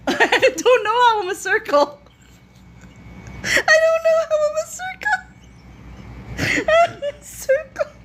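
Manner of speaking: high squeal through laughter; giggly, high-pitched disbelief; laughing bafflement. Real, not acted. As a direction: wail it helplessly while cracking up. Baffled and cracking up.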